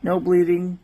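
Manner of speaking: normal talking voice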